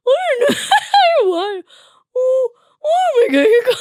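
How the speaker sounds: very high, loud squeal of laughter; slow, drawn-out words through high giggles; helpless laughing. Real, not acted. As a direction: lose the words in a real laughing fit. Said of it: "I'm laughing because I've lost my".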